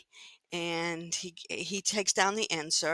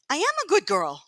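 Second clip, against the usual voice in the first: put-on indignant little girl; 7 semitones higher, 6 dB louder than usual. Child insisting to the Rebbe.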